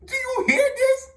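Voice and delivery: high, loud disbelieving exclamation; incredulous outburst. Real, not acted. Storyteller appealing to viewers about his dad's errand, incredulous. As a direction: burst out in high-pitched disbelief.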